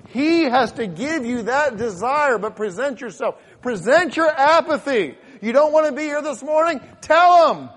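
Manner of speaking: loud, high, driving exhortation; full-force preaching. Acted. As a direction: hammer out the doubter's questions as a fervent preacher.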